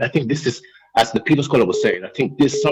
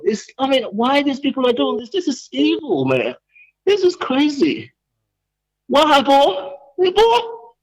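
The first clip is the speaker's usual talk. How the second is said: worked-up outrage; 9 semitones higher than usual. Real, not acted. Caller fuming on air about the audit report, high and exasperated. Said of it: "my blood pressure is like".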